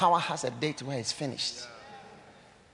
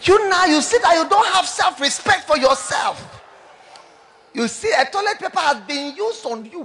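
loud, high preaching; preaching shout; 10 semitones higher, 8 dB louder than usual. Real, not acted. Preacher shouting at proud ladies in the congregation. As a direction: shout the shame home.

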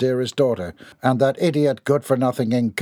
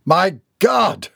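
louder, higher exclamation of horror; appalled shriek; 7 semitones higher, 5 dB louder than usual. A narrator's reading. Son appalled by his mother's confession, shrieking. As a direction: cry out, scandalised. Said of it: "shrieked Mister Longhorn".

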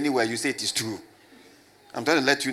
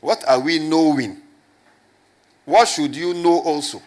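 emphatic loud questions; 3 semitones higher, 8 dB louder than usual. Real, not acted. Preacher pressing questions loudly.

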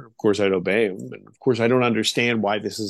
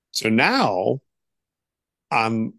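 ordinary talk

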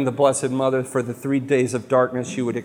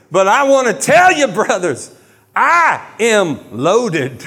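much higher, louder, then very slow mock boast; Creflo Dollar boast; 5 semitones higher, 5 dB louder than usual. Mocking a wealthy preacher's boast, high drawn-out voice. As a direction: crow it like a rich preacher. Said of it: "and he goes".

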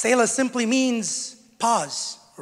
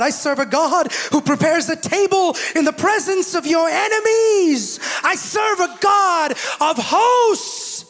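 building sermon; 7 semitones higher, 6 dB louder than usual. Acted. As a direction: climb to a shouting climax on "enemies" and "hosts". Preaching builds to full force.

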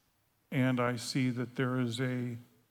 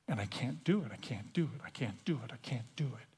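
low, hushed repetition sinking away; softly beaten down, voice dropping to near whisper; defeated plea; 5 semitones lower, 8 dB quieter, faster than usual. Acted. Praying to God that he can't, defeated and hushed. Despairing prayer, giving up.

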